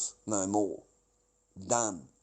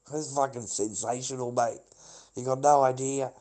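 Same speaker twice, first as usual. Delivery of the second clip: normal talking voice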